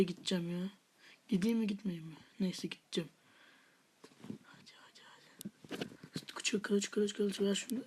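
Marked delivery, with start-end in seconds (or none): 4.4-6.2 barely audible whispered urging; urging under breath. Real, not acted. Player urging things along in the game, whispering. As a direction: whisper it repeatedly, almost without voice.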